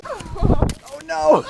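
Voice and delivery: high sudden cry of alarm; startled yelp. Real, not acted. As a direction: yelp it out in surprise. Sudden slip in snow.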